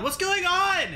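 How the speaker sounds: loud, high call down the stairs; shouting from upstairs. Acted. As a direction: shout up from above. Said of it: "people up there shouting out".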